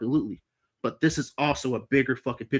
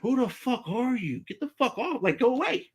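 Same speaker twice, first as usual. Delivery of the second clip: exasperated protest; 10 semitones higher than usual. Retold or imitated. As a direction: re-enact telling someone to back off. Acting out his protest to officer.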